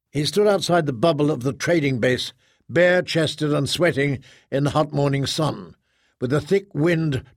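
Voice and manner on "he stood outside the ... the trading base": ordinary delivery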